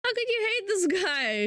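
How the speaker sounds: ordinary delivery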